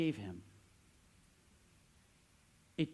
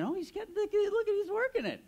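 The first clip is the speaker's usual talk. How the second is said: excited realization. Retold or imitated. Father watching his son work a trade, in a much higher excited voice. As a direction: pitch shoots way higher, an amazed inner gasp. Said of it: "and I'm thinking".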